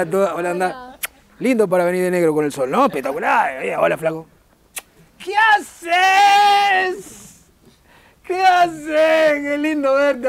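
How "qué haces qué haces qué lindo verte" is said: high, drawn-out call of hello; stretched-out, hammed-up cry of recognition; effusive shouted greeting. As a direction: holler a long, over-the-top greeting, easing down at the end. Effusive greeting of audience member.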